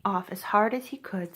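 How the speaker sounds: ordinary delivery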